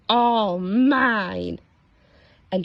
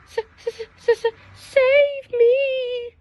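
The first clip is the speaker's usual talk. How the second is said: high, slow frightened stutter; high, loud stuttering cry for help; stuttering plea; 14 semitones higher, 3 dB louder, slower than usual. Acted. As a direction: stammer it out in terror. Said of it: "stuttered Pear".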